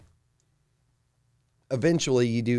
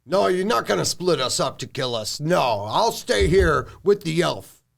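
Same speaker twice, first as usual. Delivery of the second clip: higher, insistent orc chief voice; 4 semitones higher, 3 dB louder than usual. Acted. Orc chief refusing to be split up, insistent.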